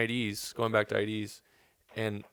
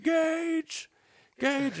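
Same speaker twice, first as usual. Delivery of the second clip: very high drawn-out call of a name; high, mock holler to a friend. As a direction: imitate a buddy hollering back. Friend calls back at wedding.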